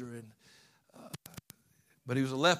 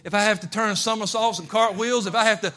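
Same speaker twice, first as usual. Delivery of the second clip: shouted, far higher preaching; preaching at full force; 8 semitones higher, 11 dB louder than usual. Acted. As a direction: build into a fervent shout. Preaching climax.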